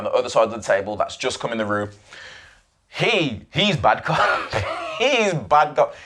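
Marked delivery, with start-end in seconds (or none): 2.9-5.9 slow, laughing delivery; slow, amused repeat; amused drawl. Real, not acted. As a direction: savour it with a chuckle. Amused at bad cop.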